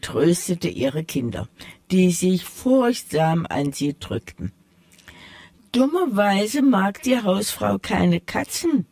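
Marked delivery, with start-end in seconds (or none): 5.7-8.9 a hint of a proud tomcat setting things straight; sly tomcat. The tomcat corrects the mice, lightly voiced and proud. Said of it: "fuhr der Kater fort".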